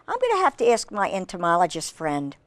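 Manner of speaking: ordinary delivery, nothing marked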